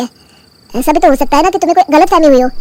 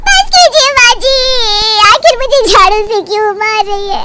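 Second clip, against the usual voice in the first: hammed-up sobbing plea, pitched up; fake crying; 4 semitones higher, slower than usual. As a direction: pretend to weep for the husband's ears. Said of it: "रोने का नाटक करती है".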